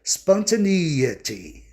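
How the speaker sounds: "Spontaneity" drawn out slowly, strong stress on the "ee" of NE. Shown as a demonstration. Teacher demonstrating stress to students, slow "Spontaneity" with strong EE. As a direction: hit a long "ee" in "Spontaneity", third syllable from the end. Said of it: "tem que ter o som I, bem forte".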